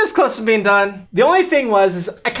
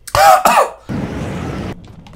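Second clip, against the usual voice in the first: gagging cough; 4 dB louder than usual. Real, not acted. Gagging on the sauce.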